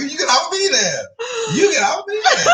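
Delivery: high laughing push-away; laughing mock scold. Owner shooing the clingy cat away, high laughing scold. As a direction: shoo the cat off through laughter.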